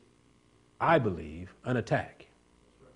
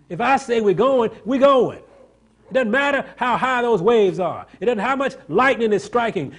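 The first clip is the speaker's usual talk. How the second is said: fiery preaching; 9 semitones higher, 8 dB louder than usual. Acted. Jesus rebukes the frightened disciples, loud and high preaching. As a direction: preach it at full force.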